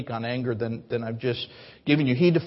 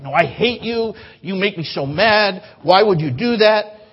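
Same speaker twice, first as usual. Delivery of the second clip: put-on higher, louder childish tantrum; angry child; 5 semitones higher, 6 dB louder than usual. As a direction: yell it like a furious kid. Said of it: "I see this with kids a lot".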